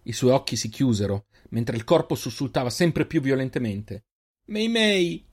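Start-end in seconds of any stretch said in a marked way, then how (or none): 4.5-5.3 high anguished shout of his sister's name; desperate cry. Acted. Reynal cries out to his sister, high anguished shout. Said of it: "urlò di nuovo Reynal".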